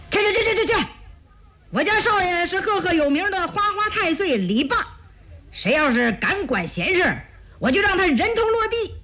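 high-pitched, officious lackey; skinny thug's shrill threat; threatening henchman. A narrator's reading. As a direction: shoo the crowd off like a bullying henchman. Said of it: "一个长得像麻杆儿的恶徒".

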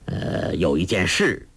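low, hesitant elder's voice; weary old monk. A narrator's reading. Monk sending the young pair away, weary and low. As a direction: speak low, with tired pauses, as an old master.

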